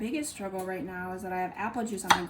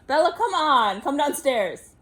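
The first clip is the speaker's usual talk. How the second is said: calling out; 6 semitones higher, 7 dB louder than usual. Real, not acted. Calling the dog downstairs.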